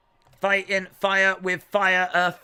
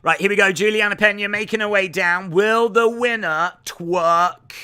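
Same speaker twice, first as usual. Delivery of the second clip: walkout announcer; 3 semitones higher, 7 dB louder than usual. Acted. Streamer calling Juliana Pena's walkout, announcer style. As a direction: boom it out like a fight announcer.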